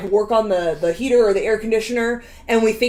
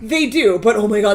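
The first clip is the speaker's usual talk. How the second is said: ordinary delivery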